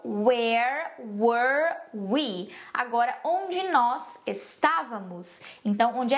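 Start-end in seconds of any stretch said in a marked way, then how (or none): 0.0-2.5 very slow, each word stretched apart; practice pronunciation. Shown as a demonstration. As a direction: model "where were we" word by word for learners. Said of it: "mas vamos praticar". (pronúncia bem devagar, palavra por palavra)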